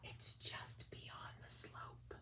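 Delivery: slow unvoiced whisper; soft mysterious whisper. A narrator's reading. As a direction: hush it like a secret guide. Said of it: "the whisper from before said".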